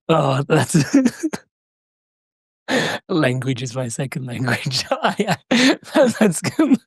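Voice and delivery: laughing through the words; real amused laughter. Real, not acted. Host laughs at the quote.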